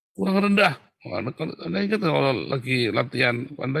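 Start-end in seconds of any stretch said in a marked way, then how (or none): 0.1-0.7 high, insistent drill call; trainer's command. Acted. Trainer to a trainee pressed down, mock command.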